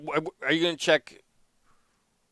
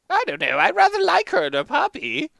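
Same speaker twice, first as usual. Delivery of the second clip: put-on higher, practical old wife; lightly voiced old wife; 6 dB louder than usual. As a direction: chime in as a sensible old wife. Gina voice, higher.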